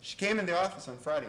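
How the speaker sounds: normal talking voice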